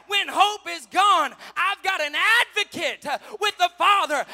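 high, very loud, driving sermon shout; full-force preaching. Real, not acted. Preacher rousing congregation about the advocate, full-force preaching. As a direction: punch each phrase to the rafters.

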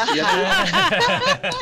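slow, high, laughing words. Real, not acted. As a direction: break into laughter mid-sentence. Laughs about her age.

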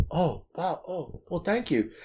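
soft, taken-aback mumble of thanks; imitating a humbled rider, quietly; small, hushed reaction; retold sheepish thanks. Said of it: "they feel like assholes".

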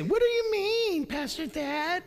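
high, whiny indignant protest; churchgoers' whine. Retold or imitated. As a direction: complain like a defensive member. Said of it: "some of you say".

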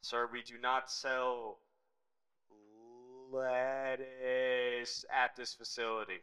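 slow, deliberate official tone; stiff clerk refusal. Pretend. Clerk refusing lettuce to a customer, slow and stern. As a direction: lay down the rule slowly.

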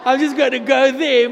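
loud, laughing bathroom joke to God; laughing mock aside. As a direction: ham it up, laughing as you tell God to wait. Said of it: "no one goes to the bathroom and says".

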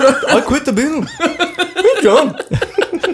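very high, hammed-up celebrity impression; squeaky-high caricature voice. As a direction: push the comic impersonation way up in pitch. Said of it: "we talked about [name] on [name]".

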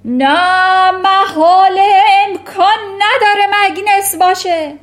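terrified headmistress shout. Acted. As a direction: scream it in panic. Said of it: "ترانچبول فریاد زد".

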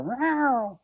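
high-pitched imitated meow; cat meow. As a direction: squeak out a little meow. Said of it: "and the cat would go".

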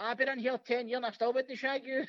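lightly acted cheek; cheeky heckle. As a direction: imitate a cheeky prisoner giving abuse. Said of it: "giving me pure cheek".

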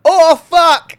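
high, loud "Oh, fuck!"; panicked shout. Retold or imitated. Boys spotting the alien, yelled in panic. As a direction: yell it in panic. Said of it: "everyone's like".

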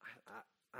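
ordinary delivery

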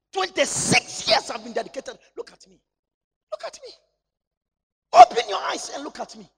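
anguished lament. Acted. Preacher acting an anguished lament.

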